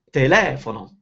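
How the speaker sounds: telefono with a long, stressed "le"; stress on second syllable. Shown as a demonstration. Reading a student's accent question, te-LE-fono stressed. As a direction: lean on "le" in telefono. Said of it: "accent on the second syllable".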